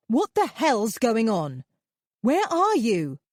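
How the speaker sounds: loud, high angry questions; furious on the phone; angry demand. Acted. Furious phone call to Jeremy.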